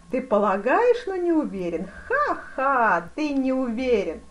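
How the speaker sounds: loud, high jeer with acted "ha-ha"; mocking. A narrator's reading. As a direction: throw the doubt back with a scornful laugh. Duke mocks the fencer's answer. (насмешливо и громко)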